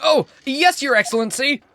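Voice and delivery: high, loud eager yes-man; eager fawning. Acted. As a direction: gush up to the judge. Fawning reply to judge.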